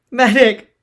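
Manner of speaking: high desperate scream; pleading yell. Acted. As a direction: scream for help. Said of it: "The Sentinel pled".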